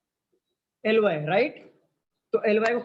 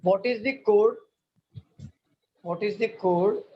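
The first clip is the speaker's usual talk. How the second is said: slow dictation; much slower than usual. Shown as a demonstration. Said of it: "teacher dictating question to students".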